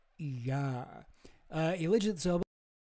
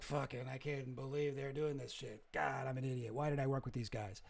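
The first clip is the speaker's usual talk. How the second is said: muttered self-reproach; 3 semitones lower, 9 dB quieter than usual. Retold or imitated. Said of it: "he talks about it in his book".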